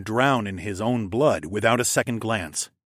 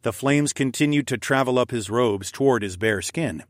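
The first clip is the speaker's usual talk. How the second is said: usual speaking voice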